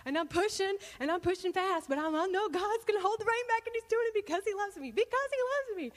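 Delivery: high, elated reenactment; overjoyed, pitch climbing as it goes; giddy happy. Retold or imitated. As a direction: build to a high, giddy peak of joy. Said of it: "I just got so happy".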